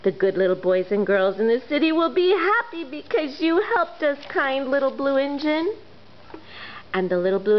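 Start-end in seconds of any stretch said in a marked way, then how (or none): 0.0-5.7 higher, thankful toy voices; grateful toys. Acted. Toys thanking the blue engine, grateful. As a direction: thank her warmly.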